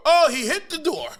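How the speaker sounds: high, loud mock exclamation; hammed-up high-pitched echo; audience repeating the joke. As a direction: parrot the punchline like a delighted spectator. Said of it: "they repeat the joke".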